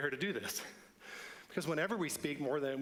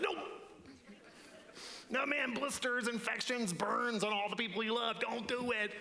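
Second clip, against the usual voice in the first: a higher, urgent conscience voice cutting in; higher-pitched warning voice; inner voice; 7 semitones higher than usual. Acted. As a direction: stop yourself before you say it. Inner voice argues against speaking.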